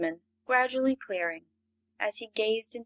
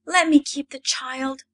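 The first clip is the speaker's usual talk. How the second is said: pleading nymph; 6 semitones higher, 7 dB louder than usual. A narrator's reading. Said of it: "beginning to tremble".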